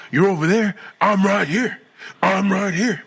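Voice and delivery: loud, high challenge; mock confrontational. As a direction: imitate a guy squaring up. Voices angry stranger in confrontation.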